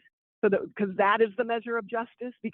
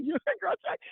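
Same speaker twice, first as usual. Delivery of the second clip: high, imitated amazed question; surprised retold question; 11 semitones higher than usual. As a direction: mimic strangers asking in disbelief, pitched up. Retold strangers recognizing her hat.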